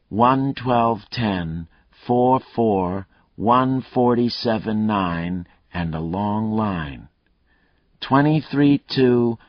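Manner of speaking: slow, deliberate reading aloud of numbers. Acted. Killer reading his code to the cop in a slow reading voice. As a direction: read each number out carefully. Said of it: "then said in a reading voice".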